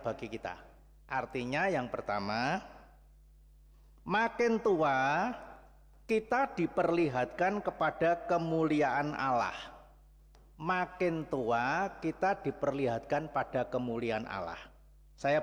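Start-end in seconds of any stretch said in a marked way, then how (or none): none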